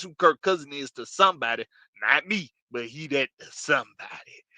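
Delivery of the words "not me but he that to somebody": usual speaking voice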